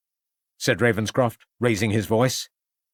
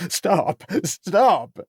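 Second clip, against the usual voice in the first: cried out; 8 semitones higher than usual. Acted. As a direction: beg them to stop. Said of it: "cried out Turco".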